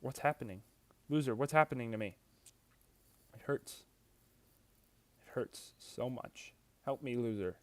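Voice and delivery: frightened and hurting, pleading; panicked pain. Acted. Character being deleted, in pain.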